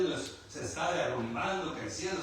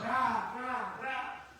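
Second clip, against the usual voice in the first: thunder imitation; 7 semitones higher, much slower than usual. Imitating thunder after describing it.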